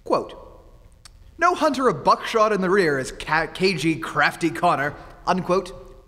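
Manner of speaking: loud, mocking headline dictation; sarcastic dictation. Writer mocking his publisher with a loud dictated headline.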